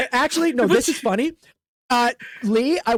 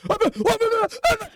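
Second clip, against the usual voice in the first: high-pitched repeated mock wail; distraught wailing; 14 semitones higher than usual. Orc hearing his brother is dead, wailing. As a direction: wail in short bursts, far up in pitch.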